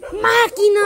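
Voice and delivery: sudden loud, high-pitched shout; startling shout. Real, not acted. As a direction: yell it out to make them jump. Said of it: "¡Ay, me asustaste!". (grito repentino que asusta)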